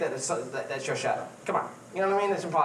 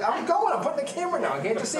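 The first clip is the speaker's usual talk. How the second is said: flustered reply; 12 semitones higher than usual. Retold or imitated. Answering Barry while putting the camera down, high flustered mock protest. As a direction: ham up the harried cameraman.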